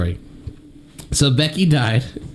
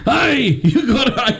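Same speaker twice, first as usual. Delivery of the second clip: put-on laughing Scottish accent; 11 semitones higher, much slower than usual. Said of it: "you can only do a Scottish accent".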